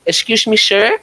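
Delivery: put-on teasing Filipino-accented stranger; fob accent. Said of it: "I say it in a fob accent".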